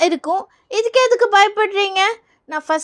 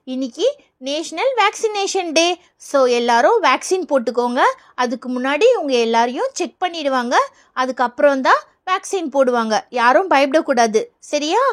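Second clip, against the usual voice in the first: ordinary talk, nothing marked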